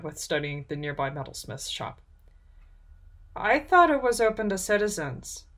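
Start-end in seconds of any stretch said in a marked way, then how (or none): 3.3-5.4 higher, tentative character voice; cautious. A narrator's reading. Kyra to the stranger behind her, cautious. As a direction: feel out the stranger carefully. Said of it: "Kyra said cautiously".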